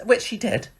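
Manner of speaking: ordinary talk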